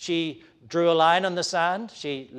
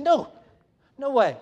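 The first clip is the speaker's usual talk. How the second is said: higher, firm grandmotherly refusal; firm, almost fierce; 8 semitones higher, 5 dB louder than usual. Acted. Grandmother-like Elizabeth refusing the name, firm, almost fierce. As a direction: refuse it firmly, pitch jumping up. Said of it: "her response is firm, almost fierce".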